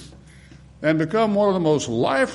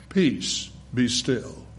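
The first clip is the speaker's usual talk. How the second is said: softer and lower, utterly calm; very calmly; 6 semitones lower, 5 dB quieter, much slower than usual. Retold or imitated. Jesus speaking to the storm on Galilee, quiet and calm. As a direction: hush the storm without raising the voice. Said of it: "I think he said very calmly".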